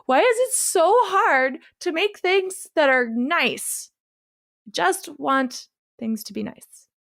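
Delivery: high, slow, drawn-out plea; plaintive lament. Real, not acted. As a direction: lament it slowly in playful despair. Mock-despairing plea for nicer films.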